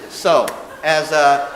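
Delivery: normal talking voice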